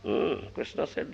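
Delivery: usual speaking voice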